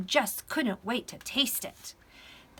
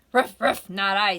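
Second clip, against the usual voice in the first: loud refusal with gruff little barks; storybook dog; 10 dB louder than usual. A narrator's reading. Dog turning down the hen, loud and doggy. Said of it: "said the dog".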